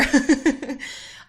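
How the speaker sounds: short high giggle; light laugh at your own joke; real amused giggle; chuckle. Real, not acted.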